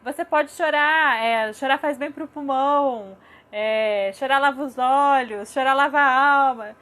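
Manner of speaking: loud, drawn-out mocking permission to cry; mock pejorative. Parent showing the wrong way to allow crying, mockingly pejorative. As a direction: stretch each phrase in hammed-up, dismissive encouragement. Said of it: "Não é tão pejorativo".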